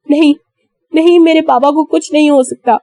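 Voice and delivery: 1 frantic denial. A narrator's reading.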